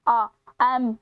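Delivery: drawn-out "आ" before "एम"; vowel sound demo. Shown as a demonstration. Teacher showing the vowel at the start of MA, sounded out. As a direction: sound out "आ" as the opening of "एम". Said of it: "Alif की जो sound है ना आ".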